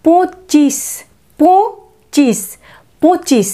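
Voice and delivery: পঁচিশ, নাসিক্য পঁ; nasal po. Shown as a demonstration. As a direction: model পঁচিশ slowly, nasal পঁ. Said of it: "পয়ের নাসিক্য উচ্চারণ".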